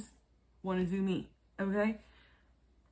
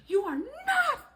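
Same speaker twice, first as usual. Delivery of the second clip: mock yelling; 11 semitones higher than usual. Production yelling at Chevelle for pushing, mock yelling. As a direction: imitate outraged yelling with a stretched, high "not". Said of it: "She just been getting yelled at".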